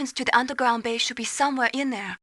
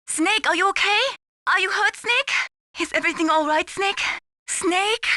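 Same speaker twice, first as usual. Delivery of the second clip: worried calling; 7 semitones higher than usual. Acted. Mei Ling worried calls.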